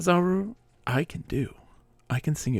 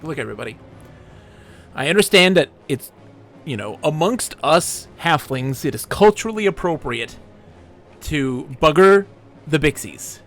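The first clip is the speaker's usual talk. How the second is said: public announcement shout; 5 dB louder than usual. Acted. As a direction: address the whole village at full volume. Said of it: "shout constantly".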